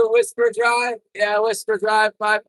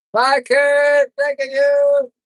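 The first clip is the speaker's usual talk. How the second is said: calling out; 5 semitones higher, 5 dB louder, much slower than usual. Real, not acted. Calling goodbye to kid.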